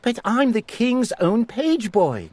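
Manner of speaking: put-on high, startled boy's protest; gasping in disbelief. A narrator's reading. Eric refusing to believe the command, gasping in dismay. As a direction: protest in shock. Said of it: "gasped Eric".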